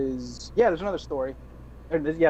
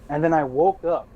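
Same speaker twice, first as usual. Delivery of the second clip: usual speaking voice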